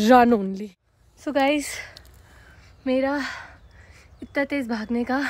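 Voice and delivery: ordinary delivery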